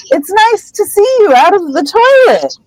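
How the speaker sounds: high-pitched fake cheer; Hermione, forced upbeat. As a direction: gush too sweetly in a high voice. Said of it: "trying to be all upbeat".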